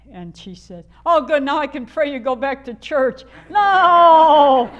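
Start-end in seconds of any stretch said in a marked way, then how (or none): 1.0-3.2 loud, much higher churchy lady voice; hammed-up imitation, loud and high; mock Lena May. Lena May's gleeful retort quoted. 3.5-4.7 very loud, much higher no; a hammed-up shouted no; mock outraged shout. Her own shouted refusal.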